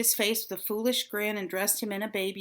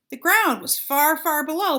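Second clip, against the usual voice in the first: usual speaking voice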